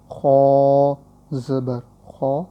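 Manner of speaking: خو زبر خو drawn out slowly; slow spelling demo. Shown as a demonstration. Teacher spells for students, slow and drawn. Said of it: "آسان ہے، خو".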